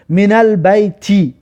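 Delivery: slow, with البیت ending in a kasra, min al-bayti; teacher modelling من البیت; slow Arabic demo. Shown as a demonstration. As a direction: read من البیت slowly, landing on the final i. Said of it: "آخرش کسره است".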